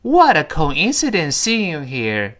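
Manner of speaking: slow, clear line for repeating; slow model reading. Shown as a demonstration. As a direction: read it slowly for students. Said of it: "再来一遍". (慢速示范朗读)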